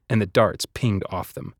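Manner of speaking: ordinary delivery, nothing marked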